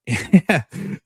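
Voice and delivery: high, loud burst of real laughter. Real, not acted. Laughing at the episode, a sudden high loud laugh. Laughing while retelling the show.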